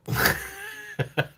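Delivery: high, sudden shriek of laughter. Real, not acted. Shrieking with laughter at the cucumber joke. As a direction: burst out in a squealing cackle.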